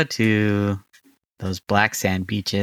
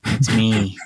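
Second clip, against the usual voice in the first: put-on New Zealand accent. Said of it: "Give me your best New Zealand accent".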